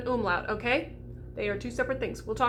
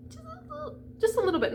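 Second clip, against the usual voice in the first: tiny high voice. Shown as a demonstration. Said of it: "shows a tiny amount".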